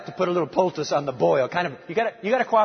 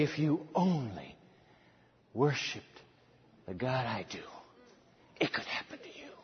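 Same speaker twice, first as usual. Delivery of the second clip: hushed witness; 7 semitones lower, 11 dB quieter than usual. Acted. The king telling envoys of his God, fading to a whisper. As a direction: let the last words fall to a whisper. What the king should have said.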